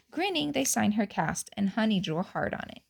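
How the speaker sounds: usual speaking voice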